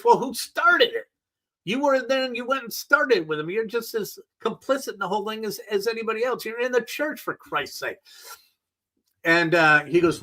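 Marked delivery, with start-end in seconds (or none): 0.0-8.0 pitched high, pushing back hard; indignant rebuttal to a reporter; high exasperated retort. Real, not acted. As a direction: argue back, incredulous and high. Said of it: "arguing back at him".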